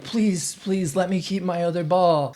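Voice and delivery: high-pitched begging victim; mock pleading. Retold suspect begging.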